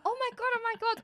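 high excited squeal. Retold or imitated. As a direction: squeal it with glee. Bursting into flatmate's room excited.

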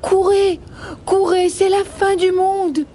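high-pitched frantic warning; panicked rabbit cry. Acted. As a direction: cry out the alarm as fleeing rabbits. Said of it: "leur crient les deux lapins".

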